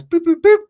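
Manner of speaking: high loud beeping noises; phone dying beeps. Retold or imitated. His phone dying on the walk, loud high beeps. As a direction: beep like a dying cellphone. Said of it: "my phone dies".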